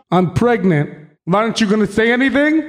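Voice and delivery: put-on quick, hurt girlfriend voice. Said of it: "favorite impressions".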